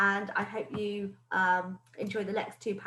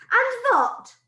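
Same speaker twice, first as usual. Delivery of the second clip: high, loud teasing shout; shouting witch accent; 10 dB louder than usual. Acted. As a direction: rouse the crowd. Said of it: "shouted the Grand High Witch".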